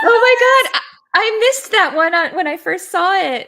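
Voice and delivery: loud, high, delighted exclamation; shocked delight, loud and high; excited. Real, not acted. Laughing at the pun.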